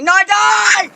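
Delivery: high, slow, loud plea; alarmed yell. Real, not acted. Gamer fears dying in the game, alarmed yell.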